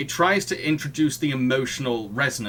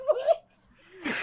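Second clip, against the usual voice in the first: much higher, laughing disbelief; a giggling, incredulous what; baffled what. Retold or imitated. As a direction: squeak it out through laughter, stunned. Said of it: "I was like".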